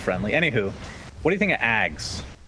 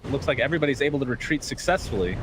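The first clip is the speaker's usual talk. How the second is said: higher-pitched esports fight call; live play-by-play; 7 semitones higher than usual. Real, not acted. Caster telling viewers who survives the fight, higher-pitched play-by-play. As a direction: call the team fight as it unfolds.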